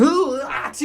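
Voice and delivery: high yelped startle noise; dad acting out his scared reaction; mock startle yelp. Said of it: "I'm like".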